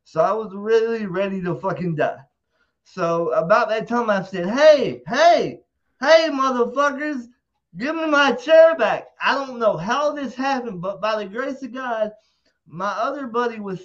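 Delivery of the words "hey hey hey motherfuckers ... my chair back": loud, higher yelling at the crowd; louder, higher repeated shout for the chair; retold shout. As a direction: holler over a mosh pit.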